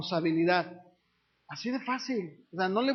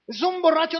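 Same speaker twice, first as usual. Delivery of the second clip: loud, high mock voice calling him a drunk; mock accuser; 6 semitones higher, 5 dB louder than usual. Preacher mimicking someone who labels a drunk, loud and high. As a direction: blurt it out like a gossiping neighbour. People excusing a drunk relative.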